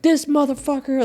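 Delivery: high-pitched mock grumble of annoyance; upset. Complaining about a surprise bill, a high annoyed mock curse. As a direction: squeal it out like the bill just insulted you. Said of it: "it would make me upset".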